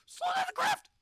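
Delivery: very high, loud shriek; panicked scream. Real, not acted. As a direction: shriek the order. Panicking as the capsule falls.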